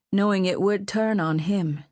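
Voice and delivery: plain reading voice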